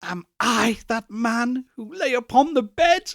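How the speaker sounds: high, desperate cry; crying out in fear. A narrator's reading. Scrooge on his knees cries to the spirit, desperate. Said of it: "he cried upon his knees".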